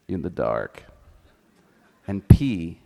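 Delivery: ordinary talk, nothing marked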